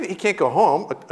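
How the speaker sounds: ordinary delivery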